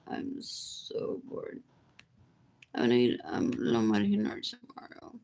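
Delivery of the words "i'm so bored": slow whisper; whispered bored. Shown as a demonstration. Repeating her boredom to viewers, whispered slowly. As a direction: breathe it out slowly with no voice. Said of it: "says it twice".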